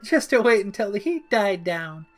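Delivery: high, sheepish rambling; high bashful Harley; shy Harley. A narrator's reading. Harley shyly explains.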